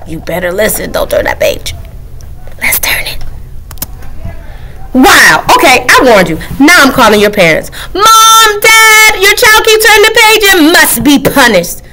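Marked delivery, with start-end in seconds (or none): 0.1-3.2 hushed whisper; conspiratorial whisper. Acted. Whispering asides. 7.9-11.8 higher, calling out; calling the parents. Acted. Book calls parents.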